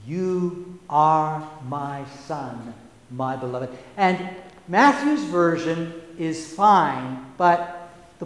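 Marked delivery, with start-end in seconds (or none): 0.1-3.7 soft and slow, as if only to him; tender, for Jesus alone. Shown as a demonstration. Preacher voicing God speaking privately to Jesus, tender and soft. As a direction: murmur it gently so just one person hears. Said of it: "Jesus needed to hear".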